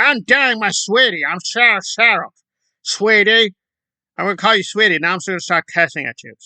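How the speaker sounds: a lightly voiced brat taunting strangers; mock snotty protester. Mocking the protester's answer to bystanders, snotty put-on voice.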